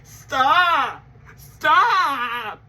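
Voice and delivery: loud, anguished stop; pleading shout. Retold or imitated. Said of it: "and then I'm like".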